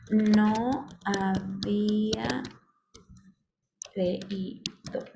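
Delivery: very slow, drawn-out words with gaps; stretched-out dictation pace. Shown as a demonstration. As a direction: spell it out word by word as if typing. Typing out an example sentence.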